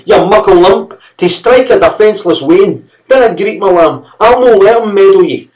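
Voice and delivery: high, loud furious Scots mother; screaming rage. A narrator's reading. Mother screaming at the servant who hit her son. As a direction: tear into the servant for her bairn. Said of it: "she screamed".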